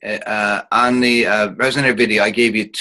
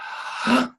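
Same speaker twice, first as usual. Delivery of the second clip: quick gasp as the mouth drops; surprise inhale gasp; 10 semitones higher, 6 dB quieter than usual. Shown as a demonstration. Teacher demonstrates the surprised inhale gasp. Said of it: "So the sound of".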